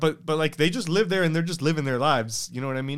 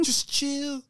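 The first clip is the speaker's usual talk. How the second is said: much higher-pitched pleading; mock exasperated plea; 12 semitones higher than usual. Comedian urging absent Putin to relax, mock exasperated. Repeats the plea after the laugh.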